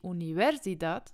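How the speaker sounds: "Universidad" wrongly stressed on -ver-; stress on middle syllable. Shown as a demonstration. Teacher showing another wrong way, middle syllable of "Universidad" stressed. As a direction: punch the VER inside "Universidad". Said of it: "wir sagen nicht".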